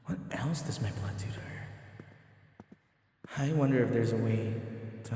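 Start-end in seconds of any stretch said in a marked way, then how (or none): 0.1-1.7 whispered thought. Acted. Private thoughts after leaving her room.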